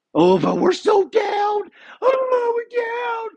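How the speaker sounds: a billionaire at a cocktail party, in a hammed-up high wail; mock whining rich guy. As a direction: ham up a rich guy crying about losses. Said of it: "And you're like".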